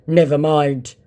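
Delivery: mocking the scammer's sulk; sulky scammer voice. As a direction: pout it out like a sulking scammer. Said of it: "came the very sulky response".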